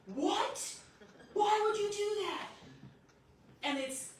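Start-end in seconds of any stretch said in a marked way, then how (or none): 0.0-2.5 high, loud scolding; mock outrage. Mom as daughter expected over broken glass, high mock-outraged scolding. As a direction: act the overblown angry parent, pitched way up. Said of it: "She was expecting me to go".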